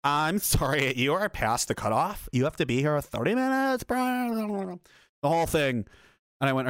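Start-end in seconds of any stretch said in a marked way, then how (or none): 0.0-4.8 drawn-out mocking clerk voice; put-on clerk apology, rising at the end; imitating airline clerk. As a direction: mimic an affected airline clerk. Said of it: "he definitely seriously talks like this".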